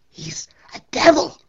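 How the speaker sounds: high, loud horrified scream; old woman screams in fear. Acted. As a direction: shriek it while crossing herself. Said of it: "she crossed herself and screamed out".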